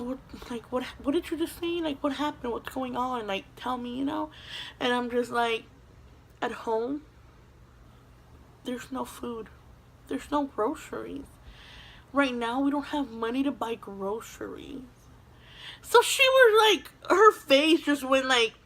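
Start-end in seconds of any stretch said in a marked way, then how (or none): 0.0-4.0 much softer, coaxing questions; quietly urging a child to open up; gentle concerned teacher. Retold or imitated. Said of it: "a super sweet lady, super caring". 6.4-14.8 very soft, halting admission; a child barely daring to say it; ashamed confession. Retold or imitated.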